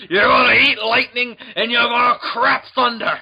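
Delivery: loud, high boxing-trainer impression; Mickey. Cornerman firing up his fighter, mock rousing shout. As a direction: belt out a hammed-up pep talk. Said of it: "'Cause Mickey loves ya".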